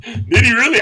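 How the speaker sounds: high, loud disbelief; can't believe it; surprised. Real, not acted. Disbelief at the passing stats.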